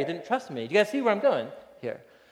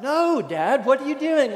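put-on higher, alarmed little kid; a small boy outraged at his dad; toddler protest; 8 semitones higher, 5 dB louder than usual.

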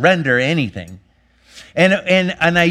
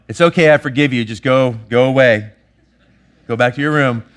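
impatient dismissal; 2 semitones lower, 4 dB louder than usual. Retold or imitated. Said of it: "I just snapped at her".

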